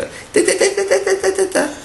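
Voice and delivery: very high-pitched rapid monkey jabber; monkey chatter. Acted. Monkeys answer the angry cap seller, shrill chattering. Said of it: "And all the monkeys go".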